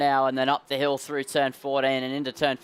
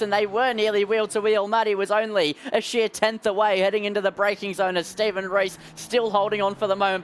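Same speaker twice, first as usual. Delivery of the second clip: raised, driving race commentary; live play-by-play; 6 semitones higher than usual. Real, not acted. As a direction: call the battle for the lead.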